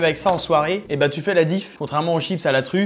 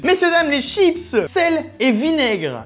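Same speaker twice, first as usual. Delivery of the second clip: high, slow grand product reveal; showman announcement; 10 semitones higher, slower than usual. Acted. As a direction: announce it like a game show host.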